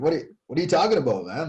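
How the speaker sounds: fast, high, incredulous what-are-you-talking-about; stunned firefighter asking a colleague what he means, fast and high; retold disbelief. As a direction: retell the shock of the moment, quickly. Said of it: "I grabbed him and I was like".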